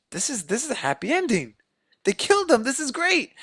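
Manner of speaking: high-pitched imitated celebration; mock delight. Voices relieved EMTs celebrating happy ending.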